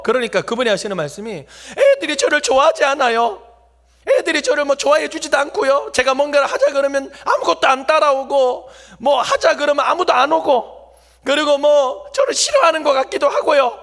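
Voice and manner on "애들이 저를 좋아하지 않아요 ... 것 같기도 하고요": put-on a touch of a weeping old teacher, pleading and high; tearful complaint. Teacher tells the pastor why she quits, mildly tearful. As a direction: sob out the complaint lightly, half-imitated. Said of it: "통곡을 하시는 거예요".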